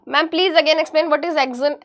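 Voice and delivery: ordinary delivery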